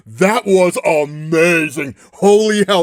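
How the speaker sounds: gushing, then a loud burst of awe; can't contain the fan excitement; excited. Real, not acted. The fan gushing about Hydra Cap lifting the hammer, loud and thrilled. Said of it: "I'm getting so excited".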